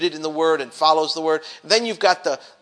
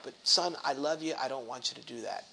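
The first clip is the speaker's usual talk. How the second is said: gentle father; 4 semitones lower, 11 dB quieter than usual. Acted. Father voiced tenderly.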